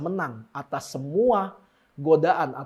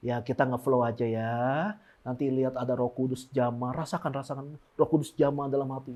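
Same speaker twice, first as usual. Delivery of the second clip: low, hushed, floaty prayer voice; soft dreamy worship leader; 5 semitones lower, 6 dB quieter than usual. Retold or imitated. Preacher mocking a lazy worship leader, hushed and dreamy. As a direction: imitate a drifting, lazy worship leader softly.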